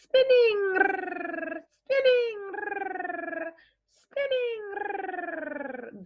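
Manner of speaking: a playful twirling voice; twist on your tongue. Shown as a demonstration. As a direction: let the word spin and twirl up high. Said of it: "with a little twist on your tongue like this".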